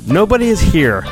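usual speaking voice

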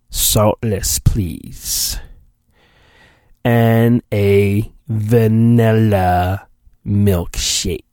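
villainous Palpatine ordering a Big Mac, slowly; Palpatine ordering food. Acted. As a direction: drag out every word like an evil emperor at the drive-through. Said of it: "Can you imagine, um, Chancellor Palpatine ordering a meal?".

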